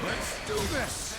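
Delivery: rallying cry. Acted. Rallying cry before fight.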